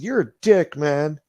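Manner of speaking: high, loud mock insult; dismissive cyclist. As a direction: toss off an insult. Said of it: "the guy said".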